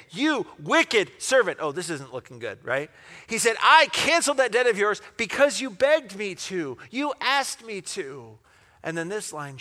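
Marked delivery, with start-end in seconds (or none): 0.1-1.6 loud, high, slow condemnation; stern rebuke. Acted. Master to the unforgiving servant, sternly rebuking. 3.6-8.4 high, loud reproach; indignant master. Acted. Master to the servant he forgave, indignant reproach.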